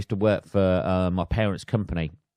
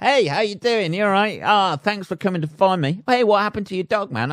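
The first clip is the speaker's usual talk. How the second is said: much higher, chirpy mock greeting; a breezy, overly friendly hello; cheery rescuer; 10 semitones higher than usual.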